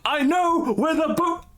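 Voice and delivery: high, slow silly voice; goofy high call in the background; squeaky voice. Acted. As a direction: pitch it way up and draw it out. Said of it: "hearing this in the background going".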